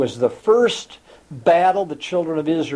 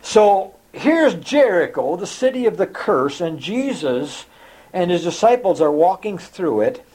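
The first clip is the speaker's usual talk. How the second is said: normal talking voice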